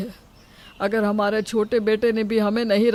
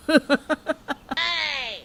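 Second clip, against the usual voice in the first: short sly laugh, pitched up; a husband's sly chuckle over the money; scheming laugh; 6 semitones higher, 3 dB quieter than usual. Acted. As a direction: chuckle at the plan to throw them out.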